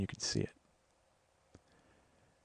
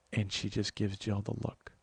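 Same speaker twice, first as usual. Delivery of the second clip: normal talking voice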